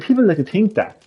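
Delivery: ordinary talk, nothing marked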